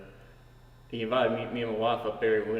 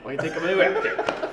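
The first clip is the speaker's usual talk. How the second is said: laughing quote; 6 semitones higher, 7 dB louder than usual. Retold or imitated. Quoting Wayne's boast.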